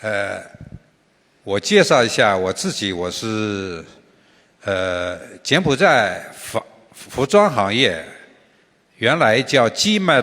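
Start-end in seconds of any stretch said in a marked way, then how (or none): none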